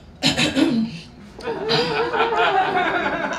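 nervous giggly throat clear; laughing throat clear. Acted. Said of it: "speaking dirty to men".

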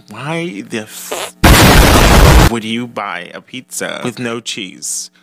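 a hint of a scoffing critic; mock incredulous viewer. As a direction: play a viewer who can't believe anyone skips cheese. Imagined viewer objects to cheeseless pizza.

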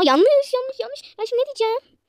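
high, loud panicked yelps; panicked cry. Real, not acted. Cook yelping as the chicken burns, panicked.

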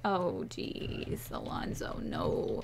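mock dismayed whisper. Player imagining Alonzo missing, mock whisper. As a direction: breathe it out like a tiny panic. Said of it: "I'm just gonna be like".

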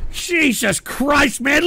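ordinary delivery

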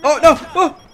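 high loud alarmed cry; alarmed shout. Real, not acted. Sudden danger in game.

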